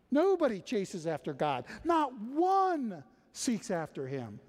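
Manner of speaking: high, loud, driving sermon voice; full-force preaching. Acted. Preacher urging the congregation that God moves first, full force.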